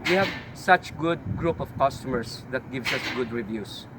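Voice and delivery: usual speaking voice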